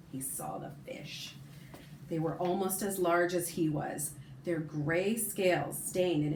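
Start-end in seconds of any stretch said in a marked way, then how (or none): 0.1-1.3 whispered reveal of the fish; suspense whisper. A narrator's reading. Suspense moment in story.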